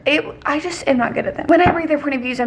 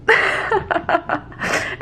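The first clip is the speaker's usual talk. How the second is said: short unvoiced giggle; airy laugh; 7 semitones higher than usual. Real, not acted. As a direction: laugh it off at yourself. Laughing at herself.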